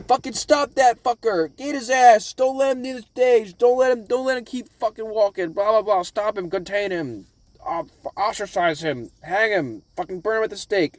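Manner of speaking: high-pitched mob shouting, easing off gradually; jeering crowd. Retold or imitated. Crowd turning on the fish man, mock mob outcry. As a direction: start loud and high, tail off into listing. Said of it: "everyone around the crowd is like".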